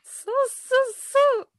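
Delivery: squeaky mouse voice. Acted. Answering what a mouse says.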